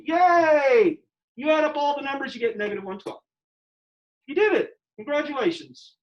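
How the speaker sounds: mock celebration, high and bright on "Yay" and "You did it"; hammed-up fake praise for a nonsense answer; sarcastic cheer. Teacher to class, mocking the result with sarcastic cheering. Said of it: "Why am I being sarcastic?".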